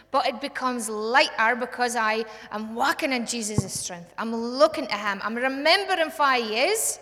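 impassioned sermon climax; full-force preaching. Acted. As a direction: preach it out to the whole room.

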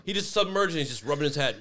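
usual speaking voice

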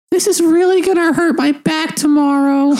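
put-on high strained old voice; character voice. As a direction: whine it out high and pained. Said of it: "And he's gonna say".

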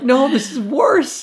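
very high, loud, drawn-out wail; mock anguished protest. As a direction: imitate a distraught kid objecting. Retold panic at skipping school.

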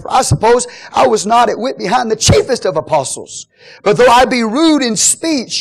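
loud, high declaimed scripture; preach. Acted. Paul defending his apostleship, full-force preaching. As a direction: proclaim it, building to a shout.

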